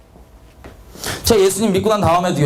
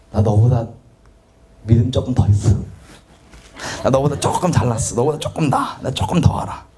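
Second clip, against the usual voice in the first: lower, sly inner boast that drops to a whisper; smug inner voice; 6 semitones lower than usual. Retold or imitated. Preacher voicing his own smug motive, falling to a whisper. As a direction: confess the hidden pride in a sneaky hush.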